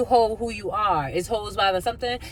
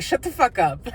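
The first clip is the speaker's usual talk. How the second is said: mock snap; 5 semitones higher, 3 dB louder than usual. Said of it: "I'm channeling you".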